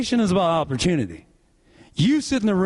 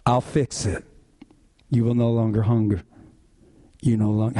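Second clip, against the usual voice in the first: lower, calm, reassuring promise; gentle Jesus; 7 semitones lower than usual. Retold or imitated. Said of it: "You know what Jesus says?".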